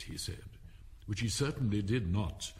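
ordinary delivery

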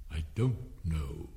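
low, slow and drawn out; contrary caterpillar. A narrator's reading. Caterpillar contradicting Alice, low and slow. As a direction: space the words out ponderously.

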